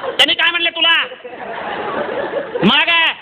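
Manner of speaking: scolding brother on the phone. Retold or imitated. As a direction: tell her off then calm her. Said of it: "फक्त ताईच म्हणाला".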